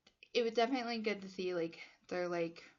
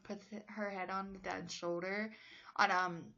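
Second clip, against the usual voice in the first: usual speaking voice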